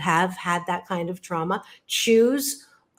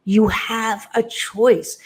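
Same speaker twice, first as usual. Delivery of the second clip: "You have a choice" said slowly, each word weighted; slow emphasis; 3 dB louder, much slower than usual. Shown as a demonstration. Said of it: "And you have a choice".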